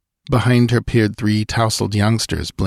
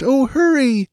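high, frantic plea; pleading woman; slower than usual. A narrator's reading. Settler woman begging Balthus to hurry, high. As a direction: beg him to hurry.

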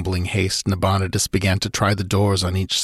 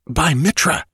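By Mitra snapped out higher with a rising end; sharp exclamation; 7 semitones higher than usual. Acted. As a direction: jolt everyone with a startled By Mitra. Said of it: "the electric quality of the exclamation".

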